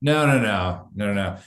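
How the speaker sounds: ordinary talk, nothing marked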